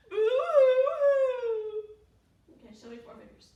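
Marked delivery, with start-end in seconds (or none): 0.1-1.8 loud, high, drawn-out boo-hoo; mock crying. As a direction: wail playfully as the sad mama cow. Mama cow crying in song.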